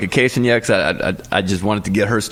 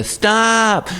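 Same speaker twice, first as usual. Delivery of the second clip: put-on kids bickering; 10 semitones higher than usual. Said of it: "there's gonna be a".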